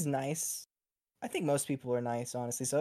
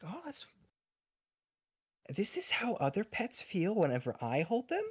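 surprised wonder; 6 semitones higher than usual. Acted. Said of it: "she's like".

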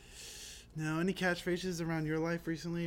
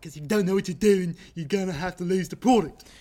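mock Baltimore yell; 3 semitones higher, 10 dB louder than usual. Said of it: "catching myself going".

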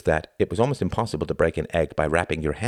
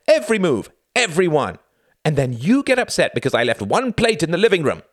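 angry rant; 10 semitones higher, 4 dB louder than usual. Acted. Reggie ranting.

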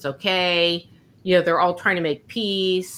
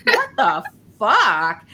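slow, higher, louder laughing what the fuck; a drawn-out, amused double take; stunned disbelief; 4 semitones higher, 4 dB louder, slower than usual. Retold or imitated. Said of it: "And he's like".

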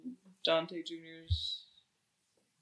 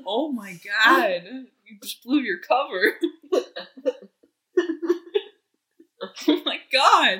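laughing; 6 semitones higher, 8 dB louder than usual. Real, not acted. Reacting to blown cover.

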